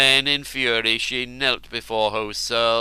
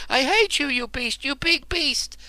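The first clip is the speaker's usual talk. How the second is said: very high raging scream. Acted. Chained woman screaming at her captor, very high. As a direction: shriek each insult at him. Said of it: "she was screaming".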